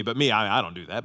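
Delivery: smug self-excuse. Retold or imitated. Mocking self-righteous inner voice.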